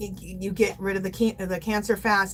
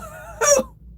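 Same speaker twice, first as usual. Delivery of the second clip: bright burst of laughter up high; laughing after blowing a party horn. Real, not acted. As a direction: laugh at her own silliness.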